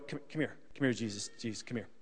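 low, hushed beckoning; confidential aside. Acted. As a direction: quietly pull someone aside. Peter pulls Jesus aside.

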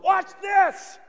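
high, loud comic boast; showing off. Acted. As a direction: shout it high to show off. Peter showing off from boat.

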